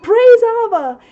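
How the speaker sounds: loud, high, ringing "praise Abba"; exultant call of praise; joyful praise. Retold or imitated. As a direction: shout the praise out gladly. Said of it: "I'm gonna say".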